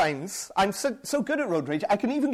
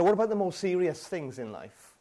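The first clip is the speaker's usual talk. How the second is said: usual speaking voice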